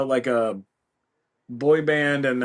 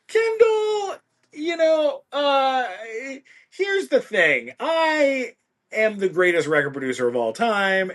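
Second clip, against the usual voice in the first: pompous boasting that starts high and settles down; Gustavo; 10 semitones higher than usual. Acted. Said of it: "record producer with a giant ego".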